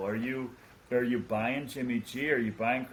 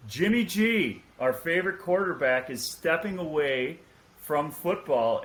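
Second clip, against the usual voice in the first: raised, loud mock anchorman announcement; mock breaking news; 4 semitones higher, 4 dB louder than usual. Host fakes a news bulletin for the panel, raised and loud. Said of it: "me breaking some breaking news".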